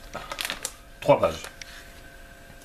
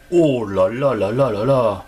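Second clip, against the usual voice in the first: loud, drawn-out amazed exclaim; excited; 8 dB louder, slower than usual. Real, not acted. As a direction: marvel loudly at the card. Rare card revealed.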